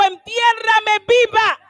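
high, slow preacher's cry; impassioned cry. Retold or imitated. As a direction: stretch it out with full conviction. Repeats it emphatically, meaning it.

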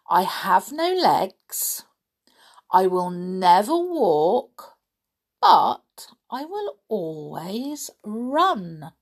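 slow, hushed, half-whispered riddle; breathy slow riddle reveal; mysterious riddle reading. Pretend. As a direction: draw them in with a spooky secret voice.

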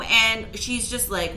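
plain reading voice

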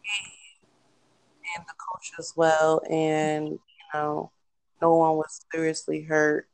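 faint, distant phone voice; cutting in and out. Real, not acted. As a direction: sound far away over the phone. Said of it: "it sound like you're here, then you're far away".

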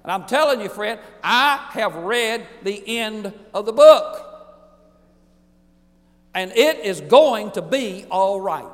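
building sermon proclamation; preaching climax. Real, not acted. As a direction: proclaim it to the congregation.

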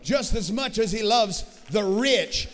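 swelling gospel preaching, near song; full-force preaching. Acted. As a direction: preach it to the rafters, building. Preaching climax on God's love.

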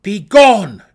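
very loud, high order; commanding shout. A narrator's reading. Drow pointing goblins down the side passage, loud command. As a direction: blast the command at them. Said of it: "Driz commanded".

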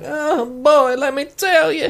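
put-on high-pitched old-timer voice; old grandfather. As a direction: play a grandad starting a tale. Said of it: "Turning into my grandfather".

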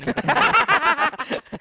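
high burst of laughter; loud laugh. Real, not acted. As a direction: break into a big laugh. Laughing at his own joke. (tertawa lepas)

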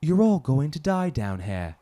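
loud, slow British with an Aussie slant; British-turned-Aussie accent. Shown as a demonstration. Going first in an accent contest, Aussie-sounding British accent. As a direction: attempt British, land on Australian. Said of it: "That sounded like Aussie".

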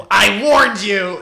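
slow, loud mock threat; hammed-up gloating warning, drawn out; villain's warning. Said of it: "points at Tim and goes".

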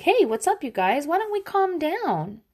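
put-on sing-song it like a kids' show host settling a fight; gentle TV grown-up. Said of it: "an adult would come in and be like".

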